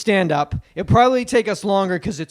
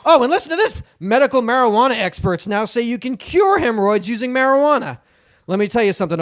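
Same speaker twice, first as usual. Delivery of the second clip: angry Jay Leno; 2 semitones higher, 3 dB louder than usual. Retold or imitated. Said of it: "He's angry guy".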